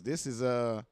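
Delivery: ordinary talk, nothing marked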